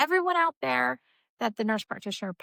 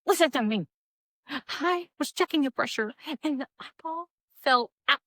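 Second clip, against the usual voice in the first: high, rising mock-frantic plea; frantic insistence; 7 semitones higher, 4 dB louder than usual. Practitioner explaining the fallen eyeball to the doctor, frantic insistence. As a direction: plead wildly to be believed.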